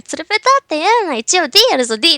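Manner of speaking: huffy shout claiming a D cup; herself as Suika. Acted. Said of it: "あるセリフをつけると私になるんですよ".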